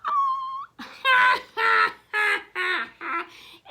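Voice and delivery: high loud witch cackle; gleeful villain laugh; wicked cackle. Acted. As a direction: let a nasty cackle rise. Viola Swamp laughs after her threat.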